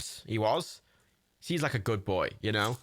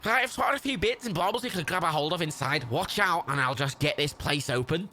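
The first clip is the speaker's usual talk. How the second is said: put-on Scouse, slightly raised; Scouse Jimmy; 3 semitones higher than usual. Scrapper planning to get the place open, put-on Scouse. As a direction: explain the plan in a Scouse accent. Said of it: "how do people speak like that".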